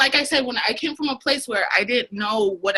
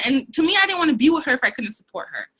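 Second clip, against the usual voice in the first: ordinary talk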